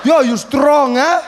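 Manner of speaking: put-on loud, laughing drunk uncle bellowing. Uncle calling across the party, loud and tipsy. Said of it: "And he's like".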